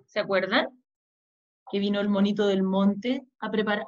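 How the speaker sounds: ordinary delivery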